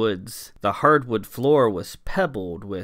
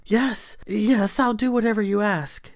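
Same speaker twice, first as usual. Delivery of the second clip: fearful servant; 11 semitones higher than usual. A narrator's reading. Servant submitting in fear.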